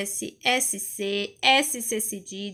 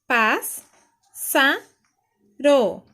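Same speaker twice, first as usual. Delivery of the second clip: loud "pás, sa, ro", a pause after each; separated syllables; 2 semitones higher, 7 dB louder, much slower than usual. Shown as a demonstration. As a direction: punch out "pás, sa, ro" piece by piece. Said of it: "Então, vai ficar".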